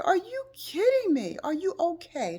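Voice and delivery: high, loud alarmed cry; shocked concern, voice flying up; alarmed disbelief. Retold or imitated. Speaker hearing friend was hit, alarmed. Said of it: "I said".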